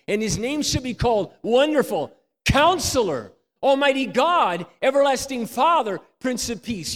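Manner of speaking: loud, high proclaiming of scripture; preaching at full force. Acted. Preacher proclaiming the verse to the congregation. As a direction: ring it out to the whole church.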